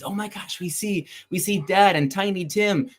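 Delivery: put-on high-pitched, eager children; children spotting Dad and Tiny Tim, excited; excited kids. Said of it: "they're really excited".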